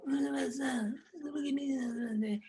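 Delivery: lightly imitated Brando, slow and mumbled; Brando mumble. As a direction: stretch the words like Brando improvising. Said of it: "just mumble".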